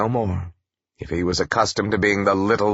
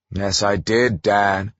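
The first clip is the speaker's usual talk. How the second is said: ordinary delivery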